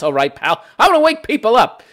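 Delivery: normal talking voice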